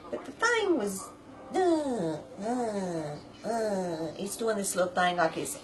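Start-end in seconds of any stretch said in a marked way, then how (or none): 1.5-4.1 imitated machine hum, falling in pitch; repeated short sliding hums; humming noise. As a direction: drone like a struggling washer motor. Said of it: "making a little humming noise".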